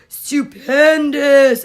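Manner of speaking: Stupendous drawn out slowly and loudly; stretched, loud stupendous. Shown as a demonstration. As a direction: savor Stupendous, slow and big. Savoring a fun S word.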